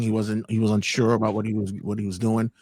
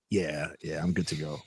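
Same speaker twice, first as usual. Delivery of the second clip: quiet reply; 7 semitones lower, 10 dB quieter than usual. Retold or imitated. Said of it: "He goes".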